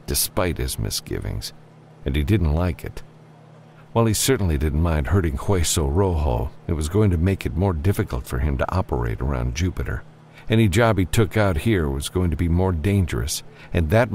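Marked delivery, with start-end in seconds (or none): none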